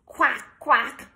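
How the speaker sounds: loud duck quack; puppy quacks. Acted. Puppy George trying to bark, a loud quack. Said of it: "George went".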